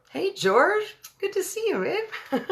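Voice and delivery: very high, loud hello; excited greeting. Real, not acted. Reader spotting an old friend among listeners, excited greeting. Greets a viewer mid-reading.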